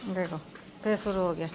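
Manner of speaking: ordinary talk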